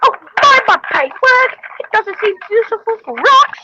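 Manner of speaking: high squeaky engine character; voicing a toy engine, high and lively; high toy train voice. Kid playing trains in a high engine voice.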